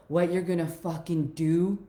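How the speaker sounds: normal talking voice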